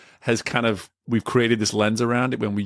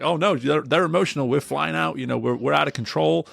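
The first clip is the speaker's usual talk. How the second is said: much higher, hammed-up alarm; mock panic; 6 semitones higher than usual. Mocking how people see emotion, in high hammed-up panic. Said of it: "it's like".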